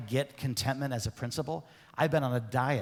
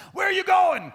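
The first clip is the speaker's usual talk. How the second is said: much higher, much louder furious boss yell; 11 dB louder than usual. Retold or imitated. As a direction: bark it like an ogre boss at an employee. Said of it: "The boss goes".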